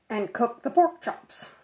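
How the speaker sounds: ordinary delivery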